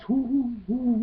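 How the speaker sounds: high, happy owl hoots; owl hooting. Acted. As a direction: hoot away to yourself, enjoying it. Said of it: "hooting away to himself".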